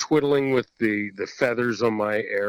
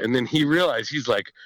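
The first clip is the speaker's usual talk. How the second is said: usual speaking voice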